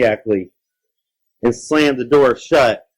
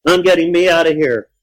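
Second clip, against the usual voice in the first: raised-pitch determined retort; insistent shout; 7 semitones higher, 4 dB louder than usual. Acted. Child at the door answers monster, insistent shout.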